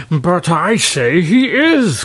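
slow, high, insistent king; crying out. A narrator's reading. King insisting to the dragon, crying out. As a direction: overrule them, slowly and emphatically. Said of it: "cried Terribus".

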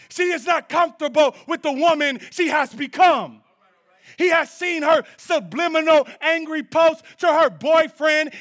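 high, loud, driving pulpit delivery rising to a shout; full-force preaching. Real, not acted. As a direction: preach each point with mounting force.

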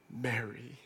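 very soft, low calling of her name; tender. Retold or imitated. Savior calling Mary by name, softly and tenderly.

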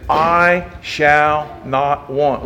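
each word of "I shall not want" drawn out slowly; solemn drawn-out scripture; slow solemn quote. Acted. Quotes the psalm.

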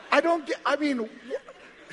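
high, loud, stammering amazement; giddy bafflement. Acted. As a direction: stumble over the words in high-pitched disbelief. Criminal baffled to be in heaven.

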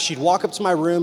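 usual speaking voice

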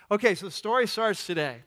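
normal talking voice

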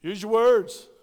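loud, firm instruction; emphatic. Shown as a demonstration. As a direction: call it out to the kids. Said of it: "What do you want them to do".

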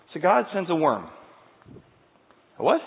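ordinary talk

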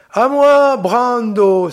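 high, loud call to a friend; wounded man shouting for his ally; cry for help. A narrator's reading. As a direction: call out to Brando across the slope. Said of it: "s'écria Orso".

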